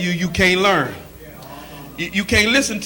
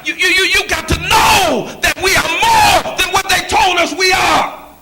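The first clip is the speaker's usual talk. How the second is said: preaching at full force; 7 semitones higher than usual. Acted. Preacher rallying the congregation against the crows. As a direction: shout it to the rafters like a preacher.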